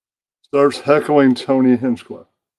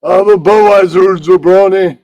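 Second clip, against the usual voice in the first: higher and louder, barking the order like a wrestler; Iron Sheik voice; 6 semitones higher, 10 dB louder than usual. Acted. Iron Sheik ordering a beer from the bartender, loud and high. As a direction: shout it at the bartender in a big wrestler voice. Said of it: "Iron Sheik goes".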